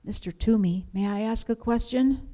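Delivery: lightly voiced hesitant little boy; whispering child. A narrator's reading. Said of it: "cleared his throat and whispered".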